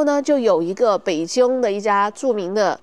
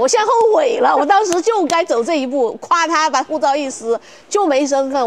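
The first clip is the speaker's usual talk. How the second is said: emphatic regret; 5 semitones higher, 3 dB louder than usual. Real, not acted. Exclaims regret about tearing passport.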